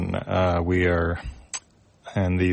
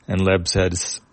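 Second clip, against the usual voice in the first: plain reading voice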